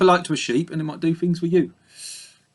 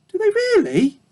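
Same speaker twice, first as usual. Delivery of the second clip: very high, wide-eyed little pig; piglet voice. A narrator's reading. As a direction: innocent piglet asking in surprise. Babe asks ewe.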